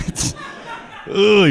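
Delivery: ordinary delivery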